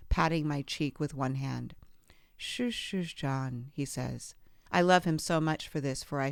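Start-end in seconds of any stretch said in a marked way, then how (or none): 2.4-3.7 whispered, soothing shush; a father whispering comfort to his sobbing daughter; soothing hush. Retold or imitated. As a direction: hush her gently like calming a crying child.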